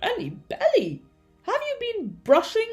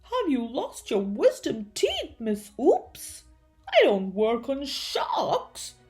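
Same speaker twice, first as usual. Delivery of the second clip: louder, incredulous refusal; exasperated dentist protesting; indignant declaration; 3 semitones higher, 5 dB louder than usual. A narrator's reading. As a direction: declare it in comic outrage. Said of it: "Doctor Dribble declared".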